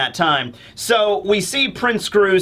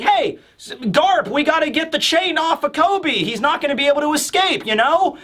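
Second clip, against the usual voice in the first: put-on higher-pitched girl urging help; playful character voice, raised in pitch; Hibari; 8 semitones higher than usual. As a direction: lightly imitate an insistent young woman. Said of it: "Hibari's there and just like".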